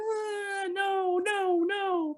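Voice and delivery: high imitated child's wail; wailing toddler. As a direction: mimic a toddler's drawn-out crying protest. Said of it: "he was in my arms crying".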